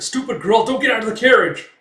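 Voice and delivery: high, loud, urgent shout; scolding warning. Retold or imitated. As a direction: yell at her in alarm.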